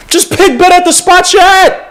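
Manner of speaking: high, pushed plea with the word "pick" leaned on; exasperated plea. Real, not acted. Fan begging the front office, rising exasperation. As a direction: beg them to just pick better.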